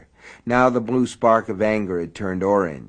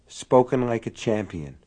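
ordinary delivery, nothing marked

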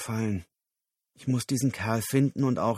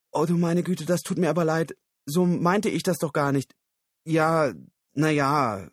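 a higher, lightly voiced apologetic woman; a touch of embarrassed Susan, pitched up; flustered woman; 6 semitones higher, 4 dB louder than usual. Susan apologizing to Lyle, lightly flustered.

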